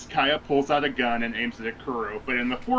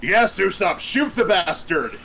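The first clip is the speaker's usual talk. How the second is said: louder, higher cheer; excited cheering; 5 semitones higher, 5 dB louder than usual. Real, not acted. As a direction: cheer him on like a fan. Cheering on a character.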